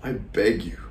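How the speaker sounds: hushed plea, rising high and soft; quiet theatrical supplication; begging. Acted. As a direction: implore them, fading almost to nothing. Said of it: "He's begging".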